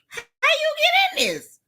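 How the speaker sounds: very high-pitched incredulous question; incredulous squeal. Real, not acted. Commentator asking how the rapper got into this, squealing incredulously. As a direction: shoot way up high in disbelief. Repeats question in mounting disbelief.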